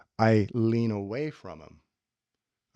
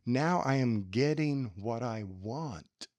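normal talking voice

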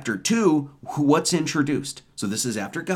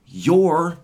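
YOUR hit higher and louder; stressed your; 4 semitones higher, 5 dB louder than usual. Real, not acted. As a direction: lean hard on "your". Lord, your individual spark.

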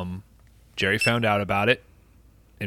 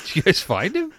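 much higher, thrilled shout; excited; 11 semitones higher than usual. Acted. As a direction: burst out with excitement. Excited news of Bat Boy.

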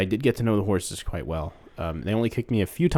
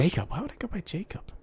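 high, soft puzzled question; baffled; 8 semitones higher, 6 dB quieter than usual. Acted. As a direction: squeak it in feigned puzzlement. Feigns ignorance of alias.

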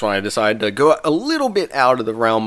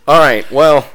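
laughing; 11 semitones higher, 7 dB louder than usual. Real, not acted. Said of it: "[name] is not having it".

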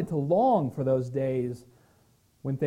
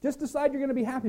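high, fast, breezy brush-off; a mock pastor telling the grieving to snap out of it; dismissive brush-off; 8 semitones higher, faster than usual. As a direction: toss it off like cheap advice. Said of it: "as me saying".

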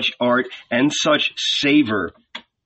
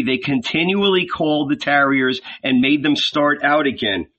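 ordinary delivery

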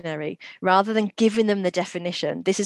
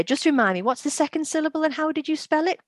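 high, bright teacher prompting voice; 10 semitones higher than usual. Retold or imitated. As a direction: coax the student with a lifted classroom voice.

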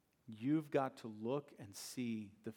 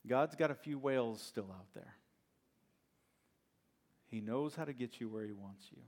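ordinary delivery

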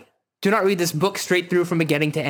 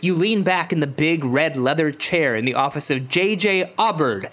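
usual speaking voice

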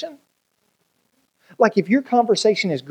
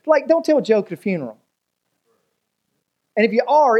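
ordinary talk

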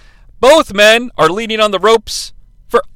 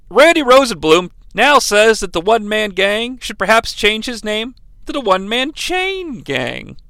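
usual speaking voice

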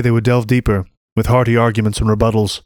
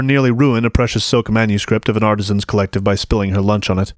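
ordinary delivery, nothing marked

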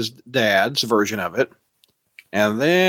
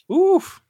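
high reactive oof. Real, not acted. Reaction to villain.

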